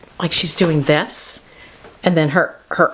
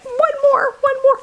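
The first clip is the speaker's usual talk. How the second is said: put-on high begging kids. Children begging in picture.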